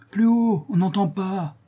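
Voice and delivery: put-on very high-pitched boy's call; heckling cry. A narrator's reading. Tortillard heckles the struggle below, high-pitched calling out. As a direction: call up high like an impatient spectator. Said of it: "cria Tortillard".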